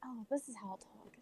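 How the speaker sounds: voiceless whisper; soft whisper. Retold or imitated. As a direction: whisper it softly. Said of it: "it's just [name] talking softer".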